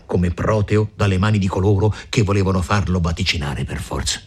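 plain reading voice

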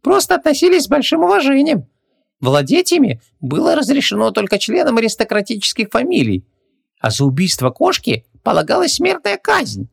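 very high pestering squeak; shrill tiny-creature voice; squeaky genie. A narrator's reading. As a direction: pipe up like a nagging little djinn. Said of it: "пропищал ей в ухо назойливый джин".

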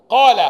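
قال called out high and loud, long open vowel; sounding out قال. Shown as a demonstration. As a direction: stretch the vowel of قال loudly. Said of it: "القاف مضمومة والقاف مكسورة".

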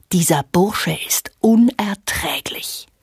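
langsam geflüstertes, abfälliges Urteil. Acted. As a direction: wie ein verächtliches Zischen hinter vorgehaltener Hand. Geflüstertes Zitat. (langsames, verächtliches Flüstern)

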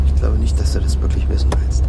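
low, hushed warning, almost whispered; ominous whisper. Acted. Arkadi warns his friend in a low ominous whisper. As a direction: let it hang darkly, barely voiced. Ominous secret about the sofa.

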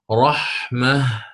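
"رحمة" slowly, ending on a silent هاء; stop on ha. Shown as a demonstration. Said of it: "والوقف على هاء التأنيث رحمة".

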